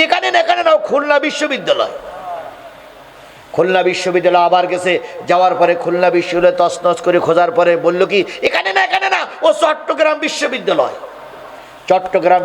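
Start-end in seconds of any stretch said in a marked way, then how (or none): 0.0-1.9 high-pitched mocking imitation; mock evasive accuser. Preacher mimicking an accuser shifting the blame, high mocking voice. 8.4-10.9 very high, mocking quote; mock evasive accuser. Preacher mimicking an accuser naming yet another place, high mocking voice.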